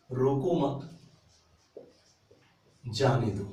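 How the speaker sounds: long pause between "मत" and "जाने"; full stop after मत. Shown as a demonstration. Teacher shows the class where the full stop falls, long pause after "मत". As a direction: say "रोको मत", stop completely, then "जाने दो". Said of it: "यहां मैंने फुल स्टॉप लगा".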